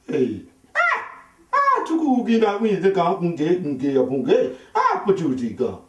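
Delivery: lightly mock gibberish African chatter with laughter; imitated African speech. Comedian imitating African men talking, as mock gibberish with laughter. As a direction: riff on the sound of an African language. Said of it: "the guy was like".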